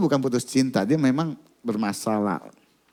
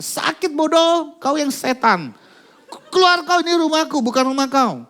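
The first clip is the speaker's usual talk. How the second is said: much higher, louder furious retort; angry girl; 12 semitones higher, 4 dB louder than usual. Retold or imitated. Girl insults the men who pinned her down, furious shout. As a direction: hurl the insult back.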